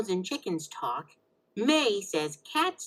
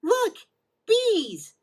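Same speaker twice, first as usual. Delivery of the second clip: excited spotting; 9 semitones higher, 4 dB louder than usual. Real, not acted. Spotting bees outside.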